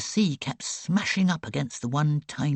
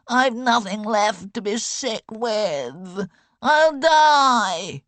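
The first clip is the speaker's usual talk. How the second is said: higher, slow self-pitying wail; 8 semitones higher, 7 dB louder, much slower than usual. A narrator's reading. Sick boy wails to girl, slow high self-pity. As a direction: wail as if doom is near. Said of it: "he wailed".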